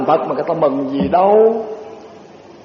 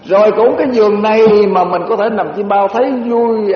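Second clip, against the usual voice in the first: usual speaking voice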